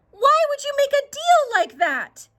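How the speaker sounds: high, loud scolding; exasperated mother. Acted. Mother scolding Jackie for the cow trade, high and exasperated. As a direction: demand an answer in disbelief.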